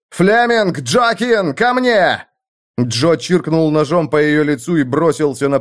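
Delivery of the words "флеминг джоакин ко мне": high, urgent rallying shout; battle yell. A narrator's reading. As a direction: yell for your comrades to join you. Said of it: "и Лёд заорал".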